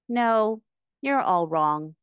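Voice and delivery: usual speaking voice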